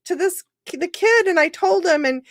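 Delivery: woman on the phone, amazed the message landed, high excited gush; excited caller. Retold or imitated. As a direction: squeal it out like a stunned, thrilled mom. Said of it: "the woman calls me four years later and says".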